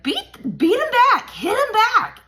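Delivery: high, loud egging-on; mock urging onlookers. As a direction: imitate bystanders goading a parent. Acting out outside onlookers urging her.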